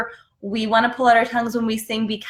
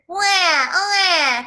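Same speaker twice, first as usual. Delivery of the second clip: put-on high baby wail; baby crying; 7 semitones higher, 3 dB louder than usual. Shown as a demonstration. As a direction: cry like a baby, higher and louder. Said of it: "how babies can cry".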